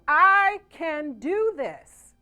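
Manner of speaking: rallying the room with enthusiasm. Shown as a demonstration. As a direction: pump the room up with each word. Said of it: "say this with some enthusiasm".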